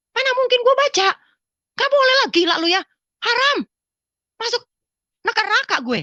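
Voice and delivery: high, loud scandalised protest; retold indignant refusal. Woman refusing the voice's order to read, indignant. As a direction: act out refusing, outraged and high.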